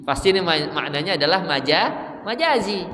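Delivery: ordinary delivery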